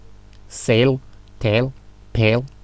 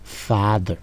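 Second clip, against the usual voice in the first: usual speaking voice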